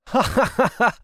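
high little cat chuckle; a playful high giggle like a cat; cat laugh. Acted. Cat spotting a bird, a high playful chuckle. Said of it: "Cat laughs".

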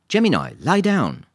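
loud, high, stretched-out order; firm dog command. A narrator's reading. Lisa makes a dog lie down, commanding. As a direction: call it out slowly and firmly to a dog.

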